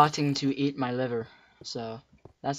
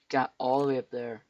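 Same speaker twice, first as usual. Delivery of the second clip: usual speaking voice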